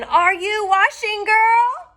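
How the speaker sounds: very high, loud witch call through a door. Acted. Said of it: "called through the door again".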